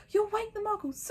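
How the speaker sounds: high sharp scolding witch; lightly voiced stern teacher. A narrator's reading. McGonagall scolds.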